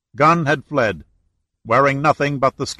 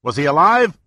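a raised, louder worried call; anxious yell; 4 semitones higher, 5 dB louder than usual. A narrator's reading. Giordino yelling to the powerboat, anxious call. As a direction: call over with dread. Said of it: "He apprehensively yelled over".

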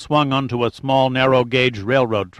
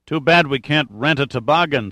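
usual speaking voice

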